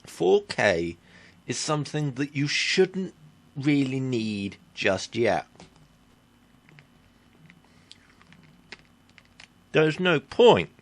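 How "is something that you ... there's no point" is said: talking around a sucked sweet; mouth full. Real, not acted. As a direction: speak with a lemon sweet in mouth. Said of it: "Sorry, I'm eating a sweet lemon sherbet".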